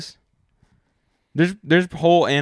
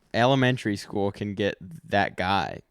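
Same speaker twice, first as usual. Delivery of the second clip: usual speaking voice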